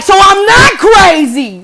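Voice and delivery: high, loud, drawn-out exclamation; stretched-out indignant shout; vindicated outcry. Retold or imitated. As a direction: re-enact a triumphant "I knew it" moment. Vindicated after girl confesses.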